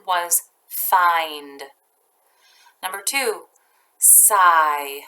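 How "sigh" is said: usual speaking voice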